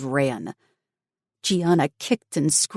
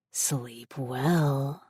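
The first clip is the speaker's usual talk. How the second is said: menacing villain; 6 dB quieter than usual. Acted. Villain drugs her captive.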